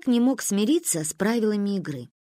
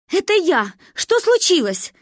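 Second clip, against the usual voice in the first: high, loud, alarmed shout; crying out; 10 semitones higher, 5 dB louder than usual. A narrator's reading. Mother answering father's long-distance call, crying out. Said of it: "выкрикнула мама".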